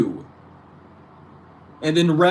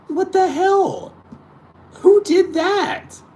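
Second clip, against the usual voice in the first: much higher, slow disbelief; incredulous outrage; 14 semitones higher, slower than usual. Real, not acted. Asking who invented this in slow high disbelief. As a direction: drag it out in disbelief.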